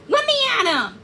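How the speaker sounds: high furious shout. Acted. Bird held back from Rothbard, furious shout. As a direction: yell to get at the enemy. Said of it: "Puffin shouted".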